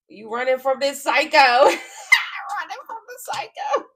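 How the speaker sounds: loud, high laughing cry; laughing shout. Real, not acted. Reader cackling as the client flees the psycho. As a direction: crack up while yelling it.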